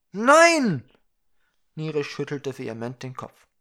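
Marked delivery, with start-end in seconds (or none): 0.1-0.9 high, loud, drawn-out refusal; defiant yell. A narrator's reading. Dwarf refusing the Ukra outright, yelling high. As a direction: shout it out high and hard.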